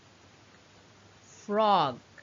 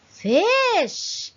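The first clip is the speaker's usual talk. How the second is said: gasp, then a high, loud "Fish!"; gasp of surprise; 4 semitones higher, 5 dB louder than usual. Acted. Teacher to young pupils, a delighted gasp of discovery. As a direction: act amazed at spotting the fish.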